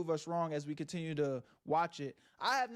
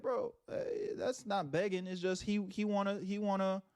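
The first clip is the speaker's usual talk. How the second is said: usual speaking voice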